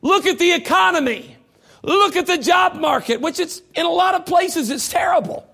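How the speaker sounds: shouted, high fiery preaching; preaching at full force. Acted. Preacher rousing his congregation, shouting high. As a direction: thunder the warning at the church.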